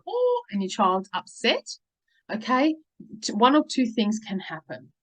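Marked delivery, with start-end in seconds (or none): 0.1-0.4 a sudden high oh of realising; startled oh. Retold or imitated. Mum realising she shouted at her child, startled high oh. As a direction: catch yourself mid-shout with a high oh. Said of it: "you stop and gone".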